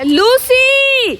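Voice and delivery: long, drawn-out high call of the name; calling the dog from far away. Real, not acted. Calling Lucy in the rain, long high call.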